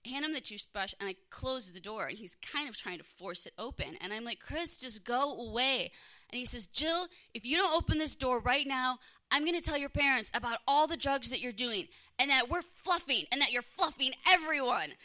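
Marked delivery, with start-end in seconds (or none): 4.4-5.9 slow, drawn-out exasperated brush-off; fed up. Retold or imitated. Fed up with the ex forcing the door, slow and drawn out. 6.7-14.9 louder, higher threatening yell; loud threat. Retold or imitated. Ex yelling threats so her parents overhear, loud and high. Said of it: "he says this loud enough for my parents to hear".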